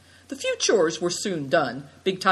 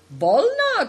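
Bolna cried out much higher and louder; eager cry; 6 dB louder than usual. A narrator's reading. Host eagerly urges Big Tiger to speak. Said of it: "cried Nai Dong".

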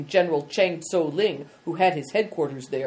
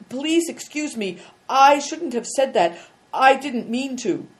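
put-on higher, flustered young boy's apology; a boy hurrying to undo his blunder; embarrassed boy; 9 semitones higher, 5 dB louder than usual. A narrator's reading.

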